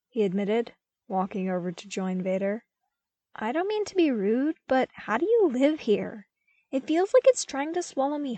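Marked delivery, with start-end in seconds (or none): 3.3-8.4 put-on lighter, higher young voice, a bit louder; a curious young man asking frankly; Luke's voice. A narrator's reading. Luke asking Vader how he lives there, higher and lighter.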